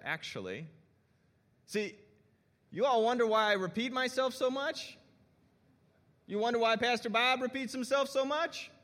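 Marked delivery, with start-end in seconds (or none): 1.7-8.7 animated. Real, not acted. Pastor defends his repeating.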